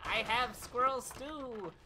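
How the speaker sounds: put-on slow, high, cracked cry of an old mountain hermit; crazy hermit voice. Recalling the Scooby-Doo hermit, in a slow crazy hermit voice. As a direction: drag out the words like a wild-eyed hermit. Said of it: "this voice that I love".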